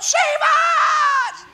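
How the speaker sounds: high mock cry for help. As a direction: yelp it out like panicked disciples. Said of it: "and they cried out".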